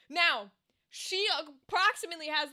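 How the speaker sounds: normal talking voice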